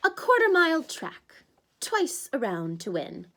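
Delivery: a smug host lays down rules; Tantalus announcing. Acted.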